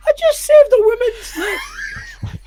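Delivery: strained, very high slow wail; hammed-up squeal of disbelief; overwhelmed protest. Protesting to friend after the rescue, mock overwhelmed wail.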